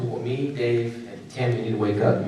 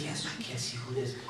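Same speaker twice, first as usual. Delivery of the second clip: mock whispered complaint; 12 semitones higher, 8 dB quieter than usual. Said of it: "crew complaining about the mask".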